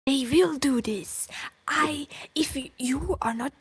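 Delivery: tense whispered anger. Real, not acted. Streamer whisper-ranting about what friends do. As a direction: hiss it out in anger.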